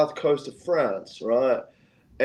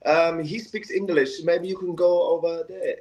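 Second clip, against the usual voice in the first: put-on French accent; 5 semitones higher than usual. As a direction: imitate a French officer in unconvincing accented English. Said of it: "mind my French accent".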